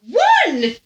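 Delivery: high, astonished questioning echo; incredulous echo. Acted. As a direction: imitate a woman aghast at the answer. English woman shocked at the answer.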